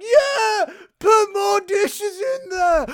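high, loud and slow cheer; cheering crowd. Retold or imitated. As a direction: whoop them on like a rowdy crowd. Crowd cheering on dishwasher race.

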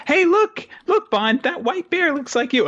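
put-on high-pitched kid pointing out the TV; excited little kid. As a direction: squeal it like a thrilled small child. Voices excited child Anya watching TV.

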